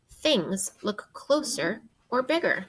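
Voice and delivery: usual speaking voice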